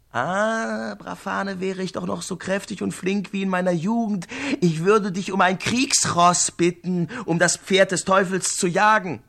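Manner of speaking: a hint of a pleading old beggar; lightly voiced beggar. The disguised beggar asks Brafane for a warhorse, lightly pleading.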